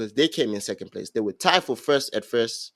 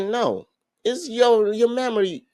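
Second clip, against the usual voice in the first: sassy correction; 8 semitones higher than usual. Retold or imitated. Partner correcting him.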